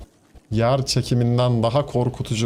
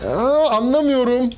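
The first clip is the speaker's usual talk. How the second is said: 1 confused cry; 12 semitones higher, 3 dB louder than usual. Real, not acted. Confused outburst at game.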